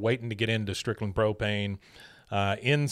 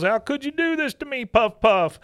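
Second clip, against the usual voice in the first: much higher, pleading whine; betrayed wail; 13 semitones higher, 4 dB louder than usual. Retold or imitated. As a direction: cry out at the hamster in betrayal. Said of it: "he goes".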